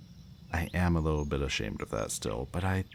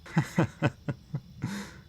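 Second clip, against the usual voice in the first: light, high-pitched chuckle; a run of short, high laughs; amused laugh; 12 semitones higher than usual. Acted. Captor to captive after joking about eating brains, amused laugh.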